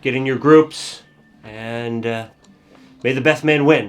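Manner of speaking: loud festival announcement; announcer voice. Acted. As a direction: call it out to the crowd. NPC announces to festival crowd.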